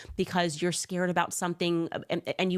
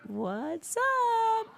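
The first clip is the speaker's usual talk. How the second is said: much higher, drawn-out mock greeting. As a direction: ape the stalling stage hello. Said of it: "Just buying herself time here".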